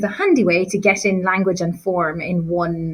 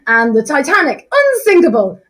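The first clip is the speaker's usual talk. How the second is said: loud, high, pompous declaration; Mr Burling voice; 7 semitones higher, 6 dB louder than usual. Retold or imitated. Teacher voicing Mr Burling boasting at dinner, loud and pompous. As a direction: boom it like a smug industrialist. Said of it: "That was better, wasn't it?".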